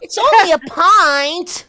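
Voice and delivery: put-on high, slow babyish voice; small kid reassuring; childish voice. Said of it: "the line you did for Baby Freckle".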